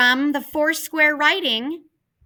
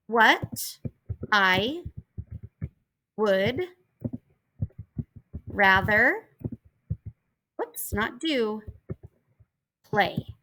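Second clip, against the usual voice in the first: slow dictation; 2 semitones lower, 4 dB quieter, slower than usual. Shown as a demonstration. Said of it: "In conclusion, comma".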